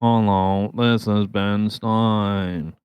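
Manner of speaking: slow, droning monotone impression; Ben Stein monotone. Host imitating a famously monotone actor, droning slowly. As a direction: drone it out, dead level and dragged. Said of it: "he was monotone".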